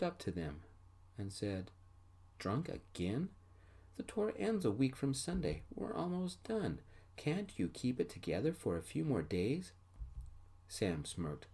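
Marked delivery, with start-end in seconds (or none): 2.4-9.7 high-pitched, disbelieving scolding; exasperated scolding. Acted. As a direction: plead and scold at a higher pitch. Bandmate scolds drunk Sam.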